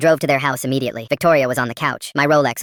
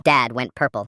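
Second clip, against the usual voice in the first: plain reading voice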